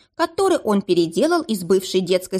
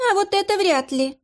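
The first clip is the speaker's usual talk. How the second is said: high, smug smirk; 12 semitones higher than usual. A narrator's reading. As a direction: say it with a sly smirk. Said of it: "усмехнулась Лиса Патрикеевна".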